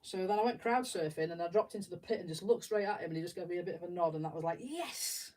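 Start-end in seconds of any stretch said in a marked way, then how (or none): 4.6-5.3 much higher, quick triumphant yes; a little private victory; thrilled. Retold or imitated. Fan noticed by the guitarist from the pit, high happy yes. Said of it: "I was so happy".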